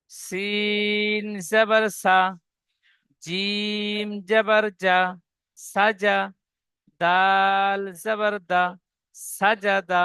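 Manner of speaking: সিন জবর সা drawn out very slowly; slow spelled-out syllables. Shown as a demonstration. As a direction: chant the spelling of সাজাদা slowly. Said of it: "ঠিক এমন ভাবে". (ধীরে টেনে বানান করা)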